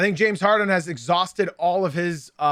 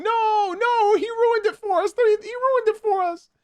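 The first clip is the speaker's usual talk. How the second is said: mock whining, much higher and in a put-on voice; whiny chat complaint; slower than usual. Mocking TikTok viewers upset he is ending the stream, whiny. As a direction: whine like a disappointed fan. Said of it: "They're saying".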